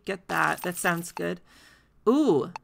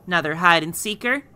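ordinary talk, nothing marked

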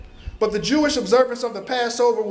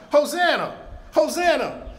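higher, proclaimed cries of praise; crowd shouting; 6 semitones higher than usual. Retold or imitated. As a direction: call it out like a joyful crowd. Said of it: "They were shouting".

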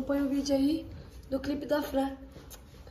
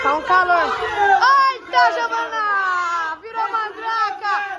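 shouting; 5 semitones higher, 4 dB louder, much slower than usual. Real, not acted. Shouting across the pool.

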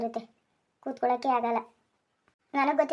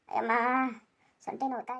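pained groan. Acted. Fallen husband groaning about his hurt back, pained and strained. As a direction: wince through the words.

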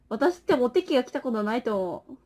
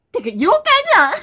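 surprised; 9 semitones higher, 9 dB louder than usual. Real, not acted. Reacting to a yokai comment.

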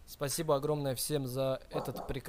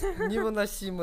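laughing it out in disbelief; 6 semitones higher, 5 dB louder than usual. Real, not acted. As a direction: groan it through a laugh. Laughs at broken mic stand.

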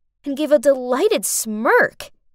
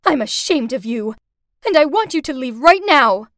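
loud, furious scolding; angry mother; 8 dB louder than usual. Acted. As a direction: throw her out of the house. Mother throwing daughter out angrily.

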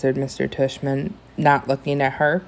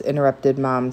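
usual speaking voice

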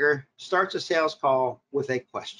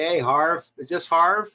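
louder, mock-chummy cold-caller greeting; overfamiliar caller; 3 semitones higher, 5 dB louder, slower than usual. Coach mimics a bad cold caller, overfamiliar. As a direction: imitate a pushy, first-name phone greeting. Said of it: "Don't get on the phone and say".

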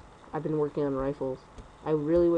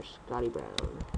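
low and slow, like a movie trailer; deep announcer voice; 10 semitones lower, 6 dB quieter, much slower than usual. Pretend. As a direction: announce the name big and slow. Announcing his character's name.

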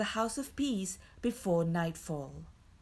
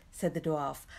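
plain reading voice

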